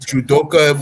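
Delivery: judoka said with an English accent. Shown as a demonstration. Instructor explaining judoka to a student, English accent. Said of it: "saying it with an English accent".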